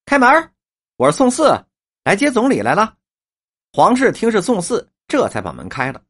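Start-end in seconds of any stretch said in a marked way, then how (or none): 0.1-2.9 higher, raised voice from outside; lightly acted caller at the door; answering from outside. A narrator's reading. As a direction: call in through the gate. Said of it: "只听外面回答".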